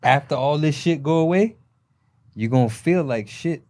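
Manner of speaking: ordinary talk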